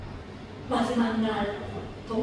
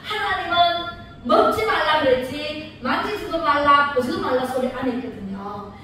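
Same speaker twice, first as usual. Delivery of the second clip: ordinary delivery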